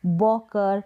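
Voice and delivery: बॉकर with a rounded "aw" vowel in the first syllable; mispronunciation demo. Shown as a demonstration. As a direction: slowly show the wrong reading बॉकर. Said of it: "इस तरीके से नहीं पढ़ेंगे".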